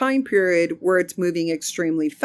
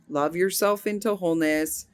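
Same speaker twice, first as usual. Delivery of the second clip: ordinary talk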